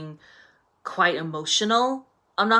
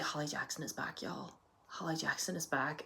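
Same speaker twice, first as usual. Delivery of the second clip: much softer, near-whispered announcement; hushed hype; 3 semitones lower, 16 dB quieter than usual. Retold or imitated. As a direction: say it under your breath like a secret cheer.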